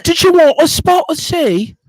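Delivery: high, loud posh British hostess voice; mock British accent. Host answers the guest in a hammed-up British accent. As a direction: play up a posh English tea offer. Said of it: "Let me hear a British accent".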